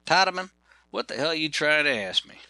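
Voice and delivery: puzzled Georgia carpenter. Retold or imitated. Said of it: "Ken, what is that".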